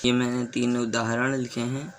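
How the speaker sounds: ordinary talk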